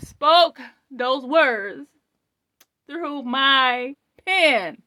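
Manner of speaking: loud, high, tearful telling, some words slowed right down; overwhelmed testimony. Real, not acted. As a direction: testify through tears, still shaken. Explaining the prophecy, still overwhelmed.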